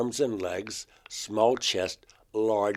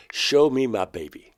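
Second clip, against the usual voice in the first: retold demand; 4 semitones higher, 7 dB louder than usual. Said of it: "I had to scream it out".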